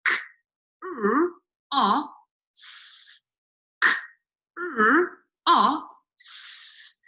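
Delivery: K, r, o, s said as separate sounds with a pause after each; teacher splitting cross into its sounds for a child; Fred Talk sounding out. Shown as a demonstration. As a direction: sound out "cross" one phoneme at a time, slowly and clearly. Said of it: "we're going to pinch the sound".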